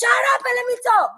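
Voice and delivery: high, angry demand to be heard; angry shout. Real, not acted. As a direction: shout over the officer. Arguing heatedly with police.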